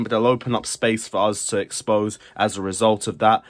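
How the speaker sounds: ordinary talk, nothing marked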